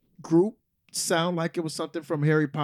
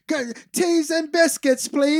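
high, loud mock-British; attempted British accent; 10 semitones higher, 5 dB louder than usual. Shown as a demonstration. Player proving they can do British, in a high, hammed-up British accent. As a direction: have a go at an exaggerated British accent, pitched up.

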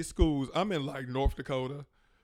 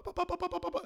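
mock lip-sync babble; faster than usual.

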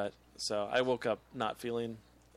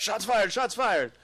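higher, louder repeated warning; mock alarm call; 7 semitones higher, 5 dB louder, slower than usual. Joking after talk of hearing shots, mock urgent alert. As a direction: call it out like a cop raising the alarm.

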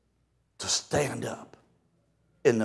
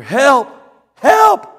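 high, loud panicked yelling; frantic cry for help; 9 semitones higher, 9 dB louder than usual. Retold or imitated. Ladies flailing in the river, shrill loud cries for help. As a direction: scream for help like you're drowning.

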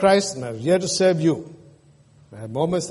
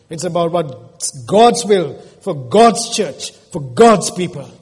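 full-force preaching, louder; 4 semitones higher, 6 dB louder than usual. Acted. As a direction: drive it home from the pulpit. Preaching the climax.

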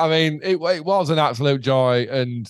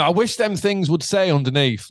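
normal talking voice

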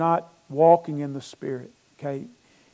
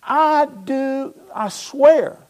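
high, insistent acted oath; raised, drawn-out vow; sworn oath; 9 semitones higher, 6 dB louder, much slower than usual. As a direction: swear it emphatically as Peter denying. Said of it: "he denied it with an oath".

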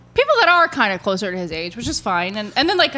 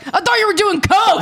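higher, mock-exclaimed dad's accusation; imitating dad; 9 semitones higher than usual. Daughter retells her dad's joke to her boyfriend, high mock accusation. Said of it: "my dad's like".

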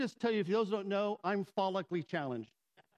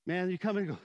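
ordinary delivery

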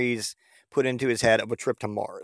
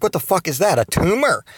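higher-pitched, mock startled question; baffled question; 6 semitones higher, 4 dB louder than usual. Girlfriend finding a lump on her back, baffled. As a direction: imitate her puzzled alarm, pitched up.

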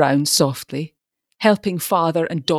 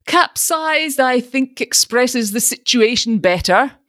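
wyraźnie wyżej, z miną męczennika; zbolały ton męczennika, grany wyżej; martyred; 8 semitones higher, 4 dB louder than usual. A narrator's reading. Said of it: "with the air of a martyr".

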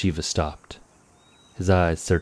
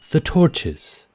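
higher and louder, firm and unyielding; unbending command; 11 semitones higher, 5 dB louder than usual. Acted. Warden orders the men to light torches, unbending. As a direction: give the order with no room for argument. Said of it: "his tone unbending".

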